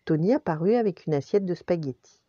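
ordinary delivery, nothing marked

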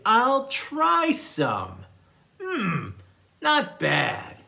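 very slow, drawn-out willingness, then a considering hum; tentative tasting. Acted. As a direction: act a lion cautiously sampling food. Lion politely tries the food.

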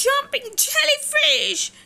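high startled cry after a bump; Sterling crying out after the crash. Acted. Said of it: "he cried".

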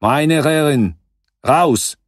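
loud, high German order; barked command. A narrator's reading. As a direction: order the men out of the truck. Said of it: "zakomenderował".

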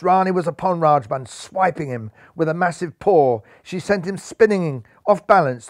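plain reading voice

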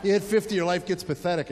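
usual speaking voice

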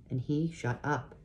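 ordinary delivery, nothing marked

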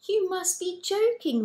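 high, loud incredulous exclamation; incredulous cry. A narrator's reading. Mary crying out to Gabriel, high loud disbelief. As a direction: cry out as if it can't be true. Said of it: "Mary cried".

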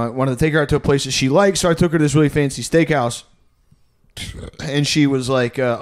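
ordinary delivery

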